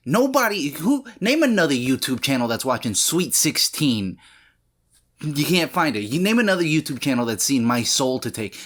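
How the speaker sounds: loud, high indignant rant; worked-up YouTuber ranting; heated rant. Real, not acted. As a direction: rail at the audience, fired up. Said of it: "I came in really hot".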